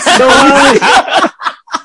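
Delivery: high, loud burst of laughter. Real, not acted. Telling friends about bribing the cop, laughing loudly.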